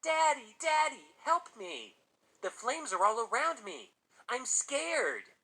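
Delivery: high, loud imitation of a frightened little boy; hammed-up terrified child's cry; mock trembling scream. A narrator's reading. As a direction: scream for Daddy as a boy faking terror. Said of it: "put on a trembling voice to imitate the little boy and screamed".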